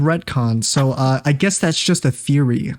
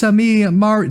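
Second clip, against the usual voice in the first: high, hammed-up Mario accent; crazy Mario voice; 6 semitones higher than usual. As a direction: ham it up like a cartoon plumber. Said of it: "I'm doing the crazy voice".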